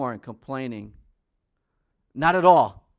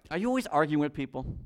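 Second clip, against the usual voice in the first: ordinary talk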